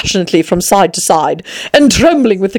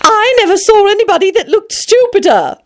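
very high, squeaky little flower voice; sudden violet; 14 semitones higher than usual. Acted. Violet abruptly insulting Alice, high squeak. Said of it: "a violet said so suddenly".

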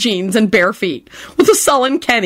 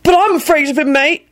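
panicked; 9 semitones higher than usual. Acted. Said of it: "panicked voice".

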